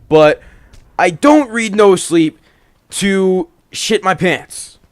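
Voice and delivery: plain reading voice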